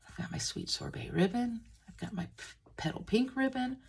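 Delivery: ordinary talk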